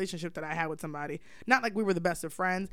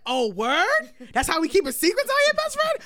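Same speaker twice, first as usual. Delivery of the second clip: mock best friend; 9 semitones higher, 5 dB louder than usual. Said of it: "Best friend is like".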